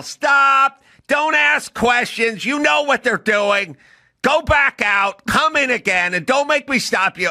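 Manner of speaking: yelling teacher. Retold or imitated. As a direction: bark orders at the student. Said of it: "And she'd yell".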